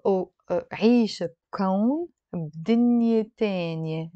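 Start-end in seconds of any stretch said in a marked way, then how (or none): 2.3-4.1 Lebanese dialect, 'teh-nyeh' in تانية; Lebanese pronunciation. Shown as a demonstration. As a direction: say تانية with a Lebanese 'eh', not 'ah'. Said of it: "not تانية".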